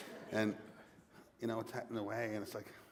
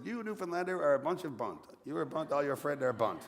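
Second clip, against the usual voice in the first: higher mock French accent, run straight through; bad French accent; 6 semitones higher, 3 dB louder than usual. Somebody behind him at the bar insulting Newfoundlanders, mock French accent. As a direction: imitate a French accent in one indignant-sounding sweep. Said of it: "bad attempt at a French accent".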